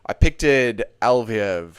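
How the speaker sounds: slow, deliberate "picked it Alviev"; careful correction. Real, not acted. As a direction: space out "picked it Alviev" carefully after a slip. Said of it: "The dyslexia has started".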